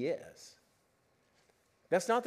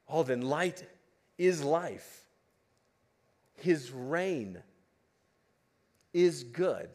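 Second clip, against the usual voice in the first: ordinary talk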